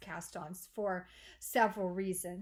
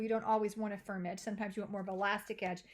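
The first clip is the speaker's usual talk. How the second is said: ordinary talk, nothing marked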